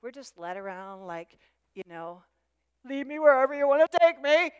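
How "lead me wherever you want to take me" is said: loud, raised put-on voice; mocking the easily led. Preacher mimicking people just led around, loud mock compliance. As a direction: play the willing follower, mockingly.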